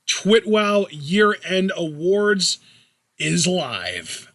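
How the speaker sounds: slow drawn-out show announcement; announcer voice. Acted. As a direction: announce it like a ring host.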